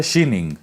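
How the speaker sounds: शीनिंग with a "shee" opening; correct pronunciation. Shown as a demonstration. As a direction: say शीनिंग the Chinese city's way, "shee-ning". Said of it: "इसका जो सही में उच्चारण है". (शीनिंग का सही उच्चारण, "शी" से शुरू)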